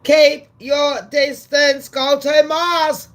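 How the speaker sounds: loud, high, slow brush-off; telling off. Real, not acted. Telling Elon to get lost, loud slow brush-off. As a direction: shout him away, dragging the words.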